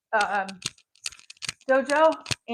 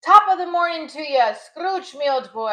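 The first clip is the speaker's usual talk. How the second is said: loud, high stage Irish accent; 4 semitones higher, 5 dB louder than usual. Shown as a demonstration. Showing an old show role, in a hammed-up Irish accent. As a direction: greet Scrooge in a hammy Irish accent. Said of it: "only Irish accent I had to do".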